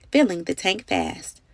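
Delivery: usual speaking voice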